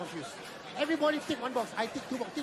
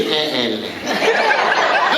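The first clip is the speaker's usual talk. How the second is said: very slow, lower "Eh, eh, leh."; unimpressed eh eh leh; 7 semitones lower, 12 dB louder, much slower than usual. Acted. Friend shows off a new Porsche, drawled reply. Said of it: "Your friend buys a new Porsche".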